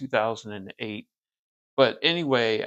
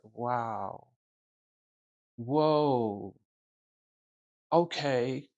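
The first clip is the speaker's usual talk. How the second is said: stunned; much slower than usual. Retold or imitated. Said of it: "And I was like".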